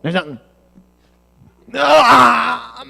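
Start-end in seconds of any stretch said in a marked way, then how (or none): 1.7-2.8 sharp high yelp of alarm; startled cry. Acted. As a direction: yelp like you've been caught. Caught, crying out.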